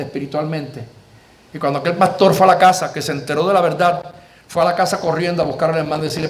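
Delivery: ordinary talk, nothing marked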